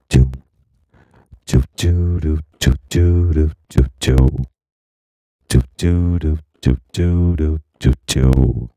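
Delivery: repeated sung syllables through a vocoder; vocoder song hook. Shown as a demonstration. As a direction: chant the hook in a synthetic vocoder voice. Famous vocoder song demonstrated. (estribillo cantado con vocoder)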